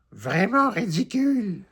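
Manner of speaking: disdainful mutter with an edge; head elf sneering; scornful grumble. A narrator's reading. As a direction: sneer at the whole thing. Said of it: "lève les yeux au ciel et marmonne".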